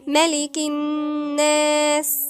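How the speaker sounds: slow recitation on one held note; ghunnah recitation. Shown as a demonstration. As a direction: hum long on the ن of الناس in ملك الناس. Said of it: "فهنقولها إزاي؟".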